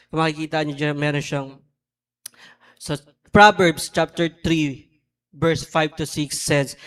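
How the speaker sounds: loud, emphatic sermon voice; preaching. Acted. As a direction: preach it out to the whole church. Preaching to congregation.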